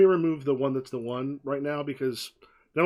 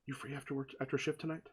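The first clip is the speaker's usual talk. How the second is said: hushed invitation; 12 dB quieter, faster than usual. Acted. Emma quietly asks him out on her way out. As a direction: slip the question in quietly.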